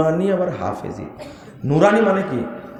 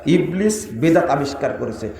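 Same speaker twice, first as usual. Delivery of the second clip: normal talking voice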